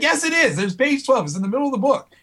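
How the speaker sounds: high-pitched mock protest; imitating a friend's worked-up contradiction; indignant insistence. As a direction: argue back, certain you're right. Reader argues the page exists.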